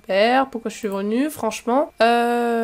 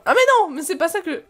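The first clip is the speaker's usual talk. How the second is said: high-pitched cry of protest; sudden high "oh no" reaction; dismayed outburst; 6 semitones higher than usual. Real, not acted.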